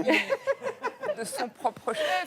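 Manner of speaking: stretched-out mock emphasis; slow drawn-out. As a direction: drag it out for effect.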